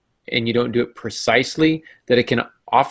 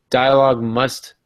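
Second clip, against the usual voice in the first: ordinary talk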